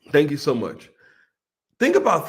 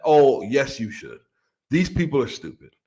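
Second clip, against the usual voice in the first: defiant retort; 5 semitones lower than usual. Acted. Answering his own scolding.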